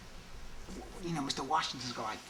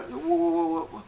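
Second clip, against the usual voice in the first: pedantic teacher; 5 dB louder than usual. Retold or imitated. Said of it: "I suddenly turn into, like, this, uh, English teacher".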